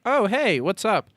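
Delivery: high, light casual hello as Sean; breezy greeting. Retold or imitated. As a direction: toss off a carefree hey, way up high. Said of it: "Sean pretty much immediately is like".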